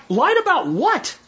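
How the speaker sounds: high, slow incredulous question. Retold or imitated. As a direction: drag it out in disbelief. Confused by the accusation.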